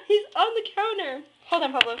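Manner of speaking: loud, urgent calling out; panicked shouting. Real, not acted. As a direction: shout in alarm at the loose fish. Fish flopping on counter.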